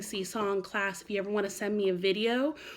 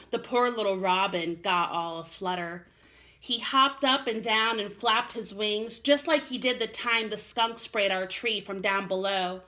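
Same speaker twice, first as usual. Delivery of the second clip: put-on gentle storybook narration for kids; reading to children; 4 semitones higher, 4 dB louder, slower than usual. A narrator's reading. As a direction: read it like a bedtime story for little ones. Teacher reads a children's story aloud.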